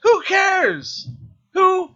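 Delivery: loud, high exasperated rant; frustrated rant. Retold or imitated. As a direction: yell it in outrage. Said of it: "I'm like".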